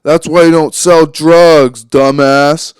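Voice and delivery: loud, drawn-out heckle; imitated yell. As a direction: yell it like a drunk passerby heckling. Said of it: "and then they yell out".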